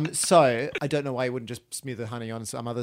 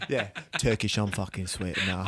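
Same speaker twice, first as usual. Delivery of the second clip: lower, softer gruff tough guy; gruff geezer voice; 6 semitones lower, 3 dB quieter than usual. Pretend. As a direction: growl it like a London geezer.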